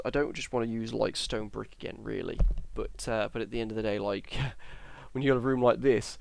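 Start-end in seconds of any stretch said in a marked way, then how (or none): 5.1-6.2 words stretched and garbled by a real yawn; talking through a yawn. Real, not acted. Tired vlogger to her viewers, speaking mid-yawn. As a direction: yawn right through the line. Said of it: "that's how tired I am".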